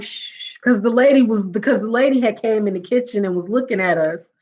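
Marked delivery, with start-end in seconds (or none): 0.0-0.6 whispered shush; hissed shush. Retold or imitated. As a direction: hush everyone urgently. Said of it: "We was trying to be like".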